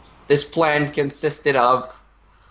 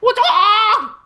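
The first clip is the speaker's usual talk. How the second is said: squeaky high-pitched what's up, much higher and louder; Jackie Chan what's up; 7 dB louder than usual. Retold or imitated. Mocking a redcoat with a cheeky high greeting. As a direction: pop the line way up high like a cartoon hero. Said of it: "pull a Jackie Chan and be like".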